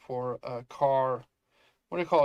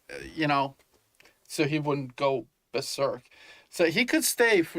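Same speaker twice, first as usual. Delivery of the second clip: ordinary delivery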